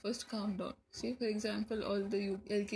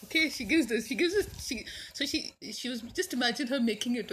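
ordinary delivery

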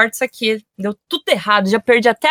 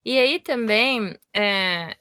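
ordinary talk, nothing marked

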